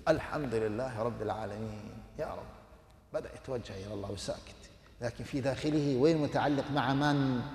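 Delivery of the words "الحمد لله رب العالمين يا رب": soft, lower whispered gratitude; silent inner thanks. Retold or imitated. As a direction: murmur thanks under your breath. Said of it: "كل يتكلم في سره". (حمد خافت في السر)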